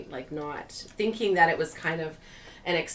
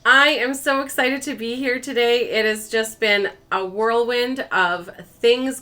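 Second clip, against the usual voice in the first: bright, upbeat host greeting, louder than usual; bubbly vlog intro; 6 semitones higher, 9 dB louder than usual. Acted. YouTuber greeting her channel's viewers, upbeat and louder. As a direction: welcome the viewers like old friends.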